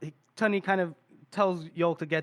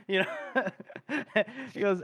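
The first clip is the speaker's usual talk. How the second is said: ordinary delivery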